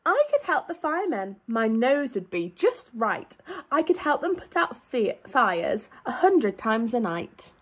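lively, sing-song storybook read; animated picture-book reading; reading to children. A narrator's reading. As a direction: read it out to a class of little kids.

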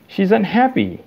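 snapping out of patience; exasperated. A narrator's reading.

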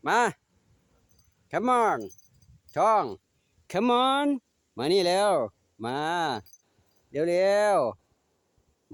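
high, loud coaxing call; calling pets. Real, not acted. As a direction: coax the animals over, drawn out.

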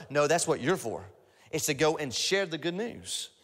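ordinary delivery

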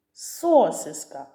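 «Сосиска» stressed on the first о, SO-syska; wrong stress on first syllable. Shown as a demonstration. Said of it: "«о» спробуй стати головним. Що вийде?". (хибний наголос на перше «о» в слові «Сосиска»)

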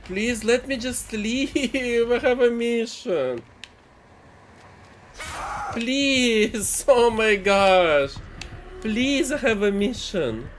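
high begging with laughs; laughing pleading. Real, not acted. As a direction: beg while half laughing.

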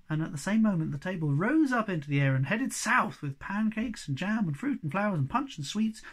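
usual speaking voice

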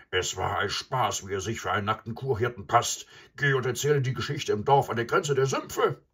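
loud, angry buffalo shouting; furious bull. A narrator's reading. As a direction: bellow the order with rage. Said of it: "brüllte der wütende Bulle".

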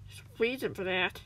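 ordinary delivery

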